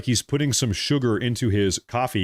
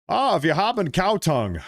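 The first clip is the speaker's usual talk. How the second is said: mock German accent, pitched higher; 5 semitones higher than usual. Imitating in-laws serving up breakfast, mock German accent. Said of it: "in their German accent".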